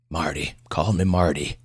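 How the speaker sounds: put-on gruff, folksy old patient; gruff old man. Old patient offers the doctor his nickname, gruff. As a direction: correct him in a rough country voice. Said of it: "Pleasanton's voice was as gruff as his appearance".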